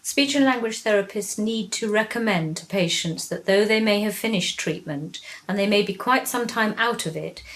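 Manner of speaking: normal talking voice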